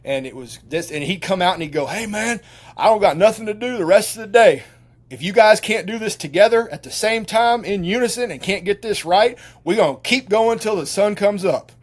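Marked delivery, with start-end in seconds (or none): 1.9-11.7 higher, louder drill-coach warning; tough coach threat. Retold or imitated. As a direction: lay down the law like a hard-nosed trainer. Coach threatens to keep going.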